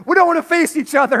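high, loud, fast playful dog voice; voicing the dog. Pastor voicing the dog in their staring game, fast sheepish. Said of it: "we'll play this game like".

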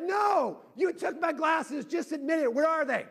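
high, loud accusing mock shout; yelling back. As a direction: act out a dad shouting back upstairs. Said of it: "I yell back".

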